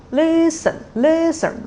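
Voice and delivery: "listen" with high held start, low finish; high-low tone pattern. Shown as a demonstration. Teacher giving learners the tone model, "listen" high then low. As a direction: step down sharply within "listen". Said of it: "So use listen, the tone".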